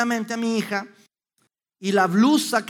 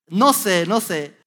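normal talking voice

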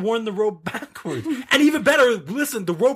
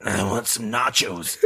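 put-on old aunt voice; 9 semitones lower, 3 dB quieter than usual. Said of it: "They're like".

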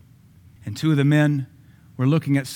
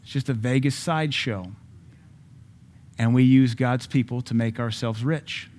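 ordinary talk, nothing marked

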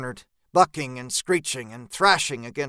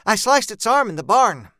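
higher, louder character voice; raised, emphatic eyewitness voice; Jean recounting; 6 semitones higher, 5 dB louder than usual. Acted. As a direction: blurt out the account, pitched up.

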